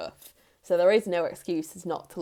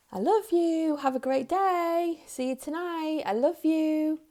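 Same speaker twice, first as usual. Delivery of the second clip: high, breezy, tossed-off "I love you"; flippant sing-song; 10 semitones higher than usual. Shown as a demonstration. A parent tossing the words off on the way out, breezy and high. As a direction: rattle "I love you" off carelessly, pitched way up. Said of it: "It means nothing".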